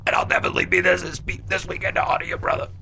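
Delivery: hushed, choking up, voice nearly gone; imitating a tough guy fighting back tears; mock choked-up whisper. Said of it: "he starts getting choked up".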